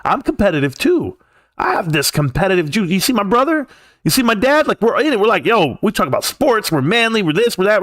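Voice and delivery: high, puffed-up imitation of a proud sports guy; mock macho boast. Mocking Pep's boast to his kids, high and puffed up. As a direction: ham up the competitive bragging. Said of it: "he says".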